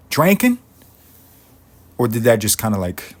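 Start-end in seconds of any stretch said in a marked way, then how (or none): 0.1-0.6 even higher, loud disbelieving squeal; a louder, higher echo of the first yelp; more incredulous. Acted. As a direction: push the disbelief further up.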